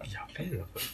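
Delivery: hushed, whispered aside; real whisper. Real, not acted. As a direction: breathe it out secretly. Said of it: "whispered aside".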